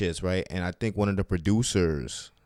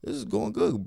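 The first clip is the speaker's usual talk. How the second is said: excited; 13 semitones higher than usual. Retold or imitated. Hyped about the taping.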